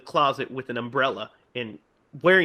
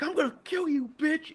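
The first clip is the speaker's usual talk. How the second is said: imitating Billy; 13 semitones higher, 5 dB quieter than usual.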